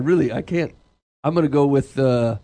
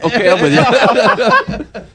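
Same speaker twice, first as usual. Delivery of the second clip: laughing; 6 semitones higher, 4 dB louder, much slower than usual. Real, not acted. Laughing realization of the joke.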